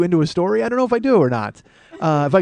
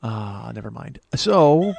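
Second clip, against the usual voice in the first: ordinary talk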